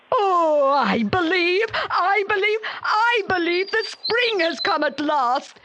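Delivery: high, excited cry building on believe; giant overjoyed. A narrator's reading. Giant sees spring return to his garden, crying out high with joy. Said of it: "said the giant".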